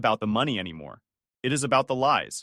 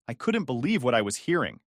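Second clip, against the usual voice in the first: ordinary delivery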